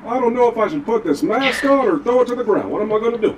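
imagined dithering player. Acted. Streamer voicing a player's thoughts, light mock dithering. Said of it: "he's sitting there going".

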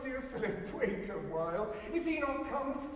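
crying out. A narrator's reading. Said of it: "she cried".

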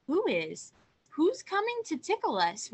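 loud, high puzzled question; bewildered storybook beetle asking out loud; baffled. A narrator's reading. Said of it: "whispered the baffled beetle".

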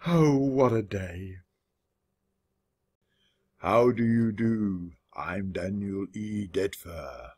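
low, slow, mournful undertaker voice; gloomy undertaker. A narrator's reading. Undertaker mouse introducing himself, gloomy and slow. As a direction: drag it out dolefully, sinking at the end. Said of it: "in a gloomy tone".

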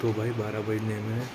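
speaking softly so mum can sleep; hushed voice. Real, not acted. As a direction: keep it low and soft at night. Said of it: "धीरे-धीरे बोल रहा हूँ क्योंकि mummy सो गई हैं".

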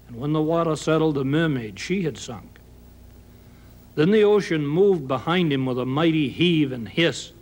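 ordinary delivery, nothing marked

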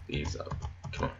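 ordinary talk, nothing marked

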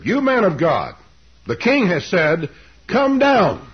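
loud, high-pitched mock order; sneering royal summons, loudly proclaimed; sarcastic command. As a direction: mockingly order him about, rising to a shouted finish. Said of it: "The sarcasm is very implicit".

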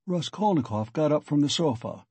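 ordinary delivery, nothing marked

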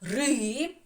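"Ри" as one stretched syllable; sounding out syllable. Shown as a demonstration. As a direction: hold the vowel of "Ри". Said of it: "Перший склад".

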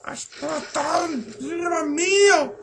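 very high squealing panic; pánico en falsete. Pretend. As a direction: squeal as if fleeing for your life. Panic after creeper explodes. (chillido de pánico muy agudo)

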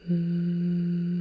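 "Mm" hummed low and steady; a low closed-mouth "Mm" hum. Shown as a demonstration. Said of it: "a bit of a humming noise".

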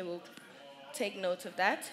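usual speaking voice

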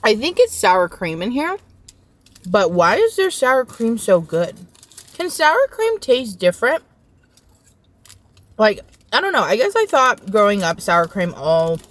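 speaking between bites; talking while chewing. Real, not acted. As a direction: mouth partly full. Seen 1: Bites just before; lips pursed, cheeks working while talking over food.

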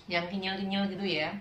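normal talking voice

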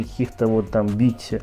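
ordinary talk

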